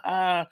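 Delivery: high burst of laughter; big laugh. Real, not acted. Laughs at the gladiator pun.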